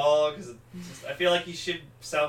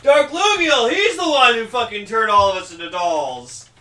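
high, loud hammed-up hero outrage; voicing Taro; 7 semitones higher, 12 dB louder than usual. Fan retelling the show, voicing Taro in a high, loud hammed-up yell. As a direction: belt it out as the furious hero. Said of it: "He's like".

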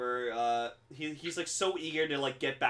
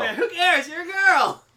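very high, loud cartoon screech; mock shouted joke; 10 dB louder than usual. Friend echoes the corrected joke, high mock yell. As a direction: yell the punchline with pitch way up.

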